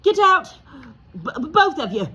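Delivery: loud, high stammering croak. Acted. Uncle Vernon to the boys, a loud stammering croak. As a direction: choke the order out. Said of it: "croaked Uncle Vernon".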